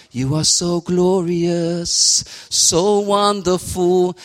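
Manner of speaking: slow, high, drawn-out adoration; worship praise. Shown as a demonstration. Preacher demonstrating praise to God, slow and high. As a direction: sing it out reverently.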